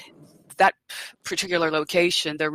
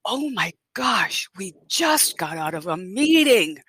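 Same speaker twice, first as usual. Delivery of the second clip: higher, gushing retold amazement; excited bank teller; 4 semitones higher than usual. Teller amazed at the customer walking in, retold excitement. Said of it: "she was all excited".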